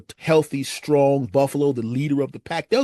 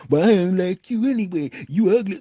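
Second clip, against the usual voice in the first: sore loser sneer; 3 semitones higher, 5 dB louder than usual. Retold or imitated. Rejected guy insults woman.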